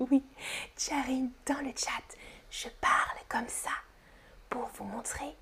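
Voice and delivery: soft whispered explanation; whispering. Shown as a demonstration. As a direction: keep it hushed and breath-only. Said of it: "que je parle en chuchotant".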